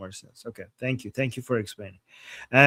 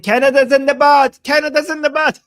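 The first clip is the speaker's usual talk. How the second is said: high, loud gleeful shout; 11 semitones higher, 10 dB louder than usual. Acted. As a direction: belt it out like a chant. Reading a funny chat aloud.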